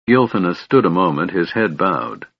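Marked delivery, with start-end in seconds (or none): none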